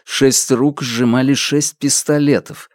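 plain reading voice

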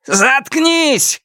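very high, frenzied yell; crazed shout. A narrator's reading. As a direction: scream it with madness ringing in the voice. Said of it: "крикнул ему Сяо Вон. В его голосе звенело безумие". (безумный крик высоким голосом)

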